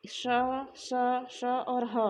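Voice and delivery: श, स, श and ह spoken one by one with a lot of air; breathy letters demo. Shown as a demonstration. Teacher showing students the sibilants, with plenty of breath. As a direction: hiss out श and स, then a breathy ह. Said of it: "ये जो तीनों श हैं, इनका उच्चारण भी करते समय मुख से हवा ज़्यादा निकल रही है".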